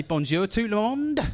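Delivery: put-on French greeting in a light Norfolk accent; hint of Norfolk accent on the French. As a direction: mimic a Norfolk teacher's French. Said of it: "spoke French with a Norfolk accent".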